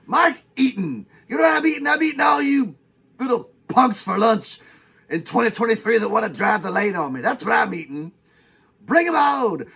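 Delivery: hammed-up furious bragging as Mark Eaton; out-of-control rant. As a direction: yell it like a giant daring punks to come at him. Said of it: "you got a little out of control there".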